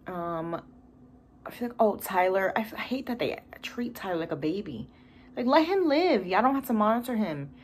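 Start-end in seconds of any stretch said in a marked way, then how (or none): 5.4-7.5 exasperated. Real, not acted. Exasperated defence of Tyler.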